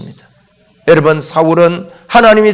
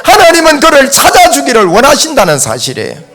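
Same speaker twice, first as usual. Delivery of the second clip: raised, high, driving sermon voice; forceful preaching; 5 semitones higher, 4 dB louder than usual. Real, not acted. Preacher urging that God seeks even deniers, forceful preaching. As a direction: press the point on the congregation loudly.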